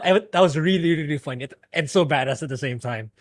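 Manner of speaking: ordinary talk, nothing marked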